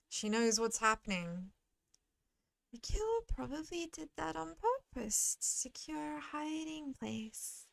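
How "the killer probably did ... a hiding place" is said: higher, gentle character voice, fading softer; soft-spoken game character. Acted. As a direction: think aloud softly as the character. Reads game character's lines.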